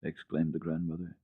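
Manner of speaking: ordinary delivery, nothing marked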